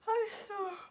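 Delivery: slow drowsy drawl, high start; nodding off mid-word; sleepy. A narrator's reading. Moonface drifting off among the poppies, sleepy. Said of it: "said Moonface".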